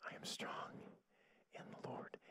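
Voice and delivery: imitating a faint, feeble whisper; barely able to speak. Sick sister repeating the minister's words, faint whisper. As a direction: echo it weakly, almost without voice. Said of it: "she could barely speak".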